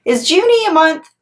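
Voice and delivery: usual speaking voice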